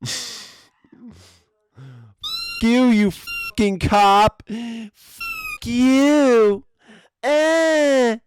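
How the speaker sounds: high falsetto, broken by sobs; hammed-up tearful high voice; mock crying curse. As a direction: blubber the curse like a sore loser. Mocking the arrested woman.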